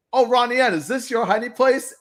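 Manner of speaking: bright surprised discovery; excited kid voice. Retold or imitated. Bobby finds Ronnie hiding.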